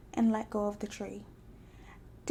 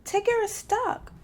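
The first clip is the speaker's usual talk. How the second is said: Roo calling down; 11 semitones higher, 3 dB louder than usual. A narrator's reading. Roo calls down from tree.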